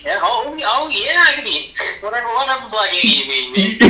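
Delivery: high, eager yes-man lightly voiced; eager sidekick voice. Mocking Don Cheadle as always happy, in a high eager voice. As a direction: play him like an overeager helper.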